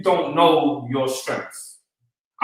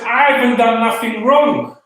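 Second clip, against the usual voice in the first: high, raised retold protest; indignant retelling of an outcry; 6 semitones higher, 4 dB louder than usual. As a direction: protest your innocence, pitched up. Retold protest after being slapped.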